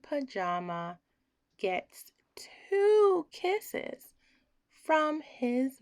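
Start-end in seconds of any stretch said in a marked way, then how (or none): 1.6-4.1 slow, high, hushed; tender whisper. A narrator's reading. Reader at the tender bedtime ending, slow whisper.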